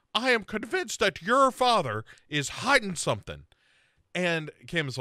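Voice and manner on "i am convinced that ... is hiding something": higher, earnest mock insistence; mock Sarah. Friend mocking Sarah's insistence, raised and earnest. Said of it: "she says".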